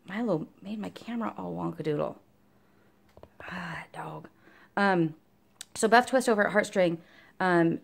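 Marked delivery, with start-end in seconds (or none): 3.4-4.3 whispered exasperation. Real, not acted. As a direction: whisper ah, dog under your breath. Muttering at the dog.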